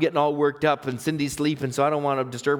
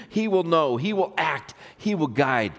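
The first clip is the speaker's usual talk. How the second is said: ordinary delivery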